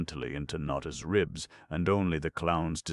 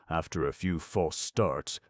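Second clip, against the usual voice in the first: ordinary delivery, nothing marked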